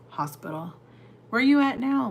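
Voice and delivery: normal talking voice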